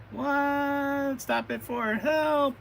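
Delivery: high, drawn-out damsel in distress; mock cry for help. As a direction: play a captive calling out in a high voice. Captured girl cries for help.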